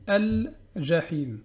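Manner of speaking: slow Al-Jahim, l clear; Al-Jahim said slowly; sounded lam. Shown as a demonstration. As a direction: pronounce the l in Al-Jahim. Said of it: "Pareil pour ici".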